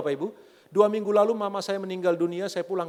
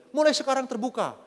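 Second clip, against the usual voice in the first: raised, higher mock-angry demand; imitating angry wife; 7 semitones higher, 4 dB louder than usual. Wife demanding openness from her husband, mock angry. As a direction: lay down the law as an annoyed wife. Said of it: "Dia marah terus dia bilang gini".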